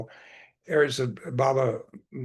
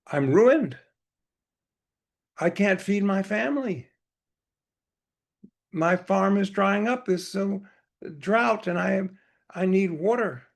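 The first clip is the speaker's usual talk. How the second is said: higher-pitched, mildly desperate plea; desperate farmer; 7 semitones higher than usual. Retold or imitated. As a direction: plead as a retold farmer in trouble. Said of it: "I'm desperate. I'm desperate".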